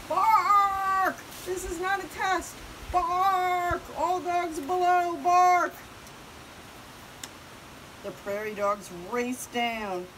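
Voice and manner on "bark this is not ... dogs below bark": high, very loud drawn-out barks; alarm shout. Acted. Watchdog warning of eagle, shouting. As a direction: bellow a warning to everyone below.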